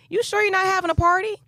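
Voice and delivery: high-pitched question, rising at the end; imitated doubting girlfriend. Girlfriend checking about a party, imitated doubtful question. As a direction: mimic a friend who doesn't believe it.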